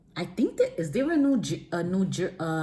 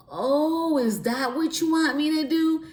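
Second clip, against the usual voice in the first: higher, mock surprised question; 8 semitones higher than usual. Realizing God sent the infomercial, surprised. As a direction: feign dawning surprise. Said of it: "I said".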